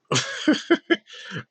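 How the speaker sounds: high, mocking burst of laughter; incredulous laugh. Real, not acted. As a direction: laugh at how absurd it is. Laughs at the idea.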